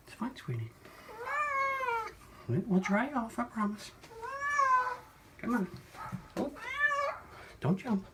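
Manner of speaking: soft coaxing pet voice; soothing a scared cat. Real, not acted. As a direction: reassure a nervous kitty softly. Calming cat in the bath.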